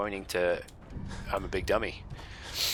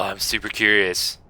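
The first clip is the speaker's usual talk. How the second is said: ordinary talk, nothing marked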